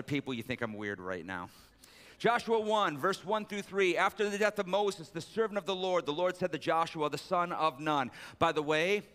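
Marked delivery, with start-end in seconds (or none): 2.2-8.1 raised, measured Bible reading voice; scripture reading. Acted. Pastor reading Joshua to his church, raised and measured. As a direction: read the verse out to the congregation.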